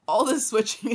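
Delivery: plain reading voice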